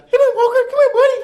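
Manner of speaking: put-on very high, squeaky baby-talk to a dog. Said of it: "That was my dog voice".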